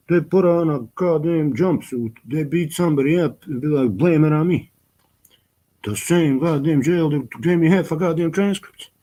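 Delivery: a real outraged complaint about the jail; indignant rant. Real, not acted. Venting about jail injustice.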